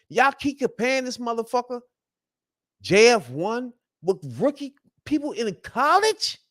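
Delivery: high-pitched indignant rant; burned up. Real, not acted. Fan fed up with the Justin Fields comparisons, burned up. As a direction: rant in disbelief, voice pushed way up. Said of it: "that really, really burns me up".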